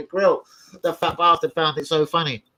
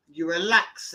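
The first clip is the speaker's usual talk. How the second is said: normal talking voice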